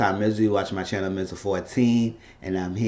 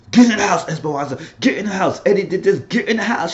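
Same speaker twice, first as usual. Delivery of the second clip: mock yell; 11 semitones higher, 5 dB louder than usual. Steven ordering Esperanza inside.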